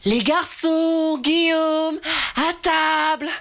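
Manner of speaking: high, slow motherly call; mother's call. Retold or imitated. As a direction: sing it out like a mother calling. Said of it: "Elle disait".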